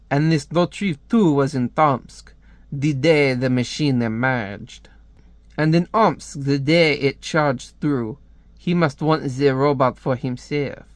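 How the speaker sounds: put-on French accent. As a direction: report grimly as a mock French spy. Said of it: "I hate doing a French accent".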